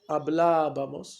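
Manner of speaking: "hablábamos" slow, with a long, strong "blá"; stress on the accented A. Shown as a demonstration. Teacher conjugating an example for the class, "hablábamos" stressed on "blá". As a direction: stretch and punch the "blá" in "hablábamos". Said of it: "acento sobre la primera A".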